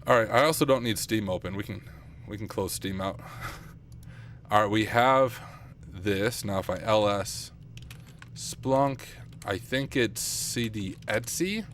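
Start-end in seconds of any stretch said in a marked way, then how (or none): none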